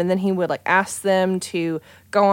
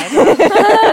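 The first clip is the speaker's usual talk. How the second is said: high burst of laughter; 14 semitones higher, 9 dB louder than usual. Real, not acted. Laughing at the breakup timeline.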